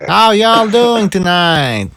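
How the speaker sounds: stretching it out in a fake Southern accent; mock Southern accent. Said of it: "she changed her accent".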